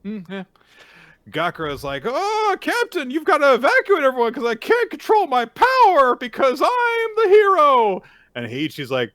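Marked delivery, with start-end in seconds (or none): 2.1-8.0 much higher, louder whiny hero voice. Retold or imitated. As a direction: play the hero as a squeaky wimp. Gakuro voiced in mock whine.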